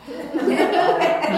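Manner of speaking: high laugh that falls away. Real, not acted. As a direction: let the laugh spill out. Laughing at the finger gesture.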